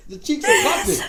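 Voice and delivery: high, loud laughing; can't stop laughing. Real, not acted. Laughing at lyric.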